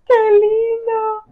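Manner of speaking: slow, drawn-out cooing squeal; gushing delight. Real, not acted. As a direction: gush over something adorable, stretching the words. Delighted with a gift, to Fabi. (chillido tierno de emoción)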